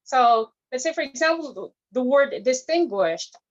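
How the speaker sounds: ordinary talk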